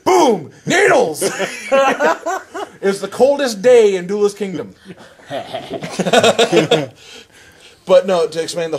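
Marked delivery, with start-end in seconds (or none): 0.0-1.2 high excited shouted sound effects. Retold or imitated. Acting out the duel's attacks. 1.2-6.9 laughing. Real, not acted. Laughing while telling it.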